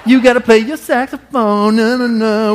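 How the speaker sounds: high, laughing sing-song taunt; taunting kids. Retold or imitated. Little sister and friend teasing him over practice, high laughing taunt. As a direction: tease like a gloating little sister.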